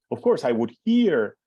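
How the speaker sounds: ordinary talk, nothing marked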